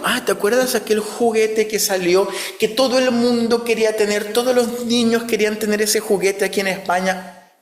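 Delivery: a hint of an eager coworker reminiscing; light coworker impression. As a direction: toss off the nostalgic office chatter, mildly acted. Quoting chatty office coworkers.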